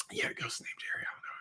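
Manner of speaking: unvoiced aside, almost no sound; hushed whisper. Real, not acted. Host whispering about the ghost in the room. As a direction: whisper it like a secret.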